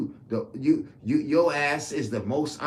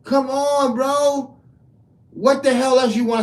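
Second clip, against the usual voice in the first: much higher and louder, yelling it out; real shouting; 9 semitones higher, 8 dB louder than usual. Real, not acted. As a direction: shout it like a rally crowd. Escalating political rant, shouting.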